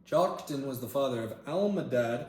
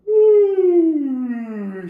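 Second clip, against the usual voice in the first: surprised loud ooh; 18 dB louder than usual. Real, not acted. Startled, then apologizing to someone.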